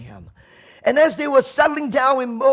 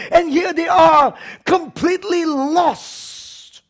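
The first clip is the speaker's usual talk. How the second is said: raised, impassioned sermon voice, pitched high; preaching; 7 semitones higher than usual. Acted. Preacher stirring up the church about the frightened disciples, impassioned. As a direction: preach it out to the congregation. Preacher building to full force.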